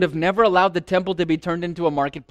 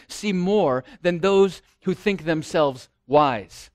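ordinary delivery

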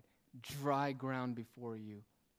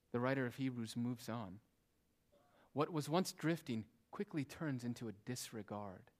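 ordinary talk

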